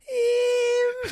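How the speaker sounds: high-pitched mimicked Scouse "um"; hammed-up Scouse, shooting way up high. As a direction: squeal out an exaggerated Scouse hesitation sound. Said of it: "it was the scousest thing I'd ever heard".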